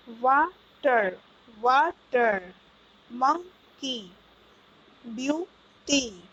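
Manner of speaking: वाटर, मंकी, ब्यूटी each cut into two slow beats; teacher's syllable-by-syllable वाटर, मंकी, ब्यूटी; split into syllables. Shown as a demonstration. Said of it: "इस शब्द का खंडन दो बार हो रहा है".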